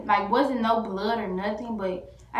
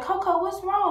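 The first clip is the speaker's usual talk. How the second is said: high, raised-voice alarm; pitched way up with concern; worried calling; 11 semitones higher, 4 dB louder than usual. Retold or imitated. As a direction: call out anxiously to a hurt pet. Frantic owner calling to hurt dog.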